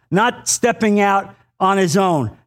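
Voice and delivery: ordinary talk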